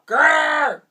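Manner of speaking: loud high shouted growl. A narrator's reading. Slinky Dog growls stomping down with all his might. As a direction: growl hard as you stomp. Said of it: "he said as he pushed".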